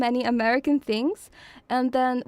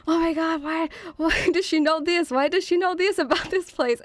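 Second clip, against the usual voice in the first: higher-pitched mock astonished questioning; imitated amazement; 5 semitones higher than usual. Everyone reacting to how much she knows, mock amazement. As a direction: act out baffled onlookers.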